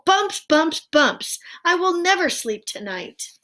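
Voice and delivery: high, loud wailing; distraught wail. A narrator's reading. Owl despairing he will never sleep, high and loud.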